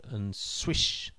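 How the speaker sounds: usual speaking voice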